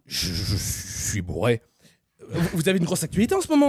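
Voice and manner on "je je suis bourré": put-on low, slow, slurred drunk mumble. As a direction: drag the words out low like a drunk singer. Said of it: "[name], est-ce que vous êtes Charlie?".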